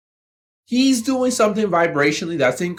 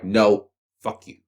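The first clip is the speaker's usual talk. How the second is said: dismissive retort; 11 semitones lower, slower than usual. Retold or imitated. Young workers rejecting boss.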